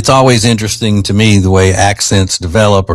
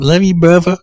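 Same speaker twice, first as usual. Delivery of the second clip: higher mock British-tinged toddler voice; imitated British accent; 10 semitones higher than usual. Host imitating the Alabama-raised British toddler, British-tinged accent. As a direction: mimic a little kid attempting British, pitched up. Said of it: "trying to get some of that British accent".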